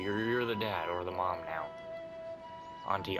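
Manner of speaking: usual speaking voice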